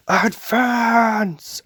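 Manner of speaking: high, drawn-out cry of advance; commander's battle cry. Retold or imitated. Imitating Irvin ordering the charge, crying out. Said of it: "he goes".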